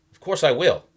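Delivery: put-on young man snapping at an overbearing stranger; snapped. A narrator's reading. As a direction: snap it back, irritated. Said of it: "Mason snapped".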